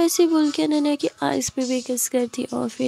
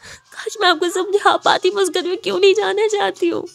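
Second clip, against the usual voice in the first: higher, louder tearful outburst; crying; 7 semitones higher, 5 dB louder than usual. Acted. Ananya cries to Khushi about home, sobbing. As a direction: plead through tears to be understood. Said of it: "ज़ोरों से रोने लगती है".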